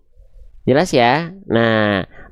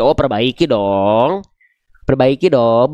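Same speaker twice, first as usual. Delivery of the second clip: teasing insistence; 5 semitones lower, slower than usual. Acted. Answers the imagined student, insisting.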